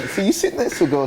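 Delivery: ordinary delivery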